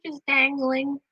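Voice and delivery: cooing. Real, not acted. Cooing at someone's dangling legs.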